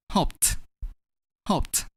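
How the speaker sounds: "Hoped" in one syllable ending in a crisp "pt". Shown as a demonstration. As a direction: end "Hoped" on a voiceless t. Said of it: "pronounce a D as T".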